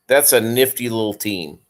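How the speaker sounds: ordinary delivery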